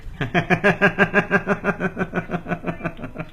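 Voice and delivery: long, high rhythmic laugh; real, sustained laughter tapering off. Real, not acted. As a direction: keep chuckling in quick, even pulses. Amused at being not understood.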